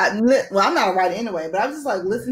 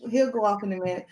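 ordinary talk, nothing marked

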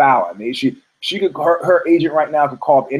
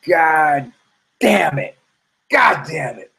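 inner groan; 4 semitones higher, much slower than usual. Retold or imitated. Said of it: "I was like".

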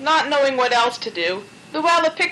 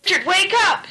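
higher urgent shout; 8 semitones higher than usual. A narrator's reading. Rapid T waking sleeping Richard, urgent shout. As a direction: shout to wake him. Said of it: "shouted Rapid T Rabbit".

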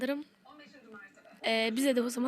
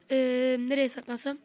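normal talking voice

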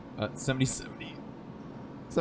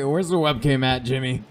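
ordinary talk

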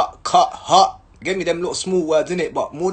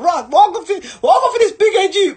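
much higher, louder gleeful mockery; mock officer's rage; 14 semitones higher, 6 dB louder than usual. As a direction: ham up the officer plotting.

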